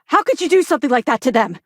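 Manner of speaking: high, loud, appalled demand; outraged accusation. Acted. Officer confronting the captain over what they did, loud outraged. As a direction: confront them with disbelief that builds.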